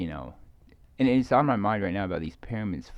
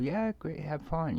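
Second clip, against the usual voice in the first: higher, bright friendly farewell; cheery send-off; 9 semitones higher, slower than usual. Retold or imitated. Sending off people boarding a ship, cheerfully pitched up. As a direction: wave them off cheerfully, pitched up.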